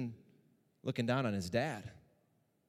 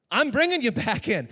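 much louder and higher, ringing proclamation; proclaimed; 9 semitones higher, 10 dB louder than usual. Shown as a demonstration. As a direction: raise it like a victory cry.

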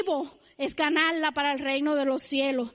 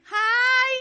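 high, loud, bright mock "Ay"; imitated cheerful greeting; 7 semitones higher, 6 dB louder than usual. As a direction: chirp it the way the American lady does. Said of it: "Cada vez que me ve ella me dice". (saludo imitado, agudo y fuerte)

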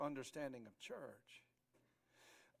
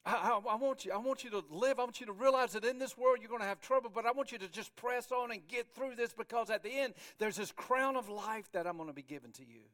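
raised, louder, pressing encouragement; urgent exhortation; 5 semitones higher, 6 dB louder than usual. Acted. Jesus urging the church to press on, urgent exhortation. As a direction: urge them on with force. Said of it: "that he's saying".

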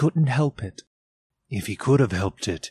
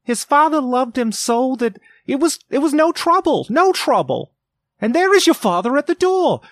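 much higher and louder, faltering then hurrying; mother's voice breaking; 5 dB louder than usual. A narrator's reading. Mother hears Bob at door, high faltering then hurried. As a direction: her voice catches, then she bustles up.

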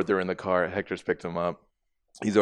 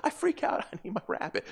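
mock little-girl panic; 12 semitones higher, 5 dB quieter than usual. Acting the girl's panic.

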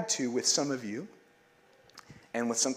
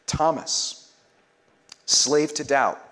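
louder, slow ringing call; liturgical call; 6 dB louder, slower than usual. Acted. Pastor leading the Lenten litany, calling each name out loud. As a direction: call the name out across the church. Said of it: "And we all say".

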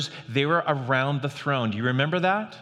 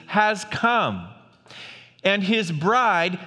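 raised, louder proclaiming read; scripture reading; 6 semitones higher, 3 dB louder, much slower than usual. Acted. Pastor reading Revelation to the church, proclaiming. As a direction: proclaim the text like a herald.

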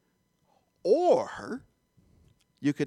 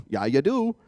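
accidental North Dakota accent, punched louder; Fargo accent; 4 semitones lower, 5 dB louder than usual. Real, not acted. Preacher rebuts a reluctant churchgoer, slipping into Fargo accent. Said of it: "That sounded like I was from Fargo, North Dakota".

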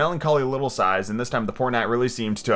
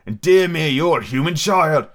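loud, raised, delighted amazement; delighted cry; 5 semitones higher, 6 dB louder, slower than usual. A narrator's reading. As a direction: exclaim with joy, drawing out the end. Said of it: "cried out in a voice of delight".

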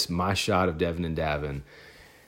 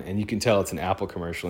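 normal talking voice

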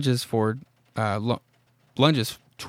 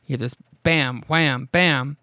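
ordinary talk, nothing marked

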